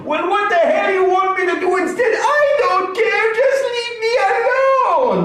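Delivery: very high, fast mock shriek; exasperated wife shout. Husband playing his wife losing her temper, high fast shriek.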